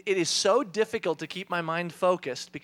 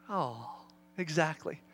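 touched father; 4 semitones lower, 6 dB quieter, slower than usual. Retold or imitated. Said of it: "And I went".

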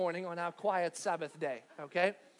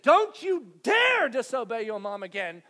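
stern scolding; 9 dB louder than usual. Retold or imitated. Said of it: "and said".

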